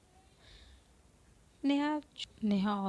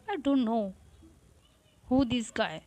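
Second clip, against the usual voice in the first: louder frustrated outburst; 2 semitones higher, 6 dB louder, much slower than usual. Acted. Neha ranting to Avantika about the guy, loud and fed up. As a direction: blurt it out, fed up.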